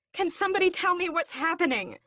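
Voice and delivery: very high, loud desperate cry; frantic scream at everyone; screamed question. A narrator's reading. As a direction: scream for an answer. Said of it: "I scream".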